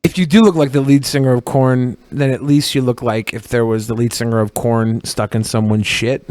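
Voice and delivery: normal talking voice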